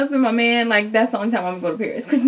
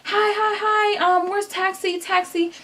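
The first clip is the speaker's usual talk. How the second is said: very high, breathless pleading; to a police officer, high frantic plea; frantic asking; 10 semitones higher, slower than usual. Retold or imitated. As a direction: squeak out a desperate plea. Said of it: "And I'm like".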